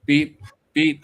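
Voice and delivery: two high mock beeps; imitated beeping.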